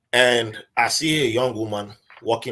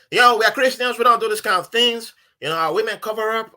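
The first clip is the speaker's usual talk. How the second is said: high, raised-voice objection; indignant protest; 11 semitones higher, 6 dB louder than usual. Retold or imitated. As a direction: insist emphatically, re-enacting the argument. Acted protest to the villagers.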